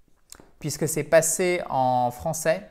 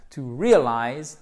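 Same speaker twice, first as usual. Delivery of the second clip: "realize" slow and louder on REAL; accent première syllabe; 5 dB louder, slower than usual. Shown as a demonstration. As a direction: punch and stretch the start of "realize". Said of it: "Accent tonique sur la première syllabe". (« realize » appuyé sur la première syllabe)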